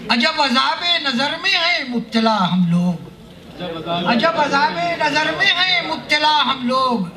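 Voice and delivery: measured Urdu verse delivery, pitched above plain talk; mushaira poetry recitation. Acted. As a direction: declaim each couplet slowly, drawing out the phrases.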